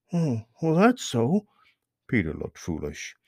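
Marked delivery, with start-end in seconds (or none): 0.1-1.5 lightly sheepish rabbit. Acted. Peter voiced in story.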